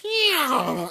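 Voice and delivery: loud yeah. Retold or imitated. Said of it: "but, like, really loudly".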